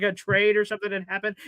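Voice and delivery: normal talking voice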